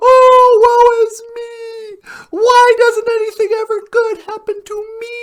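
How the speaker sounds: high, fast melodramatic wailing; mock crying. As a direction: ham up the self-pity. Acting out being sad.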